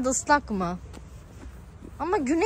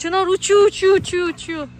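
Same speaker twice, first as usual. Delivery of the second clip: excited cheering; 5 semitones higher, 5 dB louder than usual. Real, not acted. Cheering child on swing.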